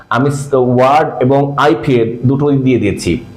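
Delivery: ordinary delivery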